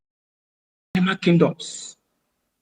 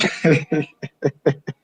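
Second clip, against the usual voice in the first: short mocking laugh. Real, not acted. Mocking the sixty-day ultimatum.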